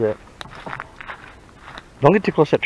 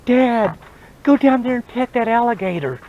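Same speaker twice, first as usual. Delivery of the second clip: put-on high, pleading kid voice; 8 semitones higher than usual. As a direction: whine it like a child egging dad on. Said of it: "to go down and say".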